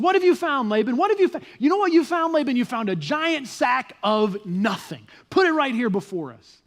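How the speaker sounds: high, loud, mocking challenge; Jacob, dripping sarcasm. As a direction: taunt him, then stretch out the punchline. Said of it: "feel the sarcasm in Jacob's voice".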